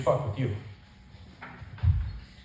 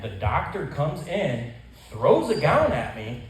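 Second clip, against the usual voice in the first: usual speaking voice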